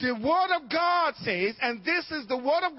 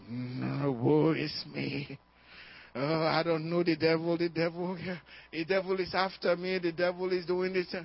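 low, moaning self-pity; hammed-up helpless complaint, voice sunk low; mock woeful; 8 semitones lower, 4 dB quieter than usual. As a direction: moan it like a put-on victim. Mimics a self-pitying, devil-blaming believer.

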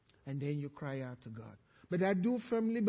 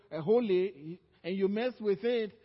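ordinary delivery

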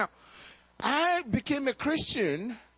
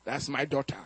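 lower, measured voice of a stern father; 9 semitones lower than usual. Retold or imitated. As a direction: weigh each word like a wary father. Father-in-law questions the suitor.

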